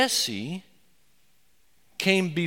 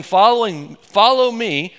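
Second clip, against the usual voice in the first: louder, higher call of a king summoning you; 6 semitones higher, 10 dB louder than usual. Retold or imitated. Preacher voicing the king calling a sinner, louder and higher. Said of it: "you hear the king calling your name".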